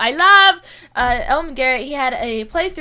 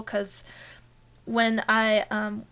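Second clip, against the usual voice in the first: usual speaking voice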